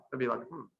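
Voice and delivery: ordinary delivery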